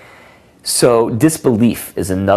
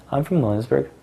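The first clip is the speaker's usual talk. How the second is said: higher, softer mock girl voice; imitating a woman; 7 semitones higher, 4 dB quieter than usual. Shown as a demonstration. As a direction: play her simple answer.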